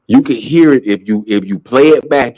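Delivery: ordinary talk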